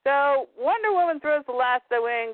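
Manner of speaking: ordinary delivery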